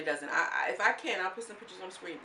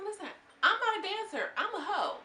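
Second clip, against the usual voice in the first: mock defiant Barbie; 12 semitones higher, 4 dB louder than usual. Voicing Barbie.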